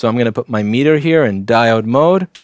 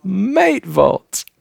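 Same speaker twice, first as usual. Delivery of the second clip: higher-pitched mock Aussie; bad Australian accent; 8 semitones higher than usual. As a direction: another go at faked Australian, pitched up. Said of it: "I can't do an Australian accent at all".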